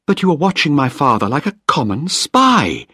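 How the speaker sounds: Penny accusing Malcolm of spying on her father, angrily; angry accusation. Acted. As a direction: throw the charge at him. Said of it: "she said angrily".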